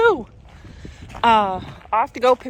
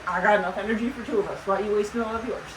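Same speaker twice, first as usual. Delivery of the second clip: quick, lightly voiced horse thoughts, a bit softer; voicing the horse; 4 dB quieter, much faster than usual. Owner voices her energetic horse talking back, fast light mock voice. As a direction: rattle off the horse's smug reply, fast. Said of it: "You're like".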